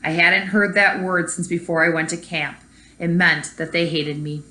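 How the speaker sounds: put-on reading a story aloud to kids; story narration. A narrator's reading. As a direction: tell it like a read-aloud storybook. Teacher reads picture book aloud.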